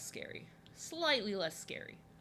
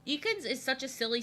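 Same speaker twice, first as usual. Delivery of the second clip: ordinary talk, nothing marked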